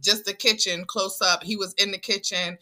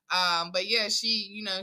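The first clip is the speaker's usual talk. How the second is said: ordinary delivery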